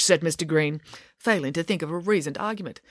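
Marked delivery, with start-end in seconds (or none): none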